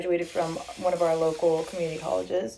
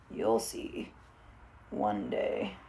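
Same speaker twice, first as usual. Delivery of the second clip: teasing whisper; 9 semitones lower, 7 dB quieter, much slower than usual. Real, not acted. Teasing viewers about bedroom reveal.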